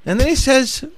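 very high, hammed-up British lady voice; matter-of-fact British wife, imitated in falsetto; chill British lady. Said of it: "can't do the real elegant, uh, British voice".

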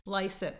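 Lycett said as LIE-sit; correct pronunciation. Shown as a demonstration. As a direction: Lycett rhyming with slice it. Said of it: "So say it correctly for me".

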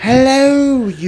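high, loud hammed-up British accent; mock British accent. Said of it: "speaking in a British accent".

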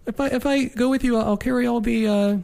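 put-on high, pleading kid voice; pestering child. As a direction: beg like an annoying little kid. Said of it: "Gee, Max".

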